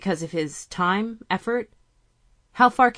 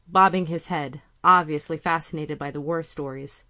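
usual speaking voice

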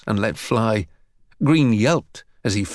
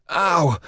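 acted "ow", pitched up; short higher-pitched exclamation of hurt; cry of pain; 6 semitones higher than usual. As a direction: yelp from a punch. Harry punched in the mouth.

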